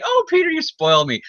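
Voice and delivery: put-on very high old-aunt falsetto; doting Aunt May. Aunt May thanks nephew Peter from hospital, high mock-doting voice.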